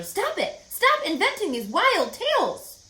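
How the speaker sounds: mother stopping her daughter's tall tales, sharp scold; snapping. A narrator's reading. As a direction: shut the wild tale down hard. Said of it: "snapped her mother".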